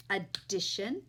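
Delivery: ordinary delivery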